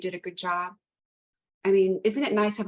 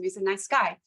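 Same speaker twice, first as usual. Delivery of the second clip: ordinary talk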